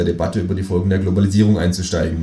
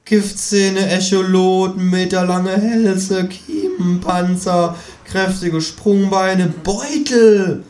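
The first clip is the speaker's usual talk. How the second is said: high, drawn-out marvelling list; high-pitched kangaroo holding forth; opinionated kangaroo; 11 semitones higher, 4 dB louder, slower than usual. Acted. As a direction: savour each item in a high kangaroo voice. Kangaroo figure lists nature's gifts.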